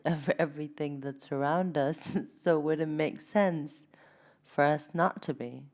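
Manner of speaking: ordinary talk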